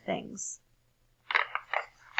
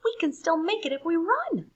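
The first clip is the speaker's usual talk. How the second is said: urgent; 9 semitones higher, 5 dB louder than usual. A narrator's reading. Hurrying to make the bell.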